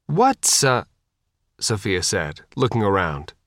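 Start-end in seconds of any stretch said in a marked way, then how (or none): 0.1-0.8 high start, halting midway; breaking off. A narrator's reading. Sophia starts asking, looks around and stops, breaking off. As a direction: begin the question and falter. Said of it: "then stopped".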